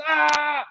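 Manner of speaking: mock strain cry pitched high; straining yell. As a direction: roar like you're shoving a tractor. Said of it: "starts pushing and going nuts".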